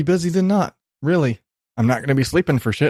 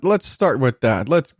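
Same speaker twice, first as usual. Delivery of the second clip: normal talking voice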